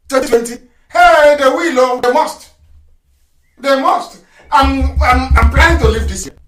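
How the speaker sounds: high, loud, emphatic declaration; fervent preaching. Real, not acted. Broadcaster insisting to listeners they must, loud and fervent. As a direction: proclaim it with full force.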